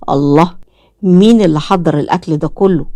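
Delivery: ordinary delivery, nothing marked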